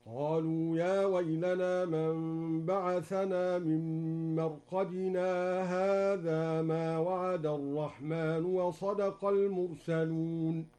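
slow chanted recitation, no pause after مرقدنا; Duri reading, recited. Shown as a demonstration. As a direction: recite straight on from marqadina without the stop. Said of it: "يقرأ هكذا". (بلا سكت على مرقدنا مع ترتيل)

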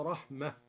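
رحمة ends in an audible h, raḥmah; stop on the ha. Shown as a demonstration. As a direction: close رحمة on a soft breathy h. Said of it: "ووقف على رحمة الله بالهاء".

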